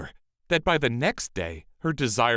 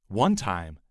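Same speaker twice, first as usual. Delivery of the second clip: usual speaking voice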